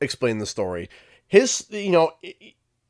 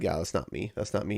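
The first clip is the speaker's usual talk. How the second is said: low, very soft aside; quiet, low inner remark; self-dismissive aside; 10 semitones lower, 13 dB quieter, faster than usual. Retold or imitated. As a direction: say it under your breath to yourself. Private doubt while sitting in audience.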